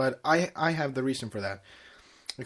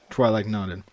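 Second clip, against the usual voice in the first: usual speaking voice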